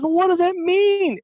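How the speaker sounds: high-pitched, drawn-out demand; demanding wife. Retold or imitated. Wife pressing in acted quarrel.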